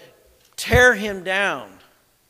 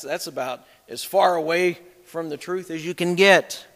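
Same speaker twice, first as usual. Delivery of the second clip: ordinary talk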